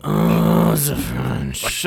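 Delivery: very deep voice. Acted. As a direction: drop way down dramatically. Hammy deep announcer bit.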